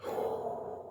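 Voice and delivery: shaky crying exhale; a long sigh fighting tears; tearful sigh. Real, not acted. Overwhelmed by the memory, sighing through tears.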